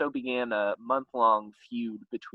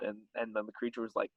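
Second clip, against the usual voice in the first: ordinary talk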